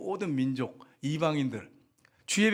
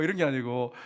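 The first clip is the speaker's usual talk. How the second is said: ordinary talk, nothing marked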